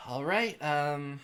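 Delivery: brisk lightly voiced doctor.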